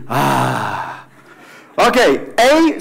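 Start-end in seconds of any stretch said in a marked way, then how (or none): 0.1-1.0 relaxed sigh. Shown as a demonstration. Teacher ending the relaxed number drill, long sigh. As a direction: let out a slow sigh of relief.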